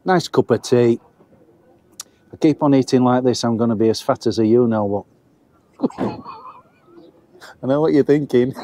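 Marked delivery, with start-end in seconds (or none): 5.8-8.5 laughing. Real, not acted. Joking about getting fat.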